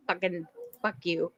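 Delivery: ordinary delivery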